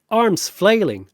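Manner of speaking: ordinary delivery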